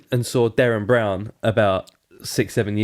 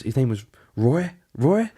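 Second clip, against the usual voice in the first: Roy sung out much higher; mock medium reveal; 4 semitones higher than usual. Derren Brown naming the granddad to Dan, Roy pitched way up. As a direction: stretch Roy up high like a showman. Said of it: "then he'd be like".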